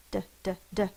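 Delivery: "d, d, d" as separate, even drum taps; tapped d sound. Shown as a demonstration. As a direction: tap out a short "d" three times. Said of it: "My tongue is tapping".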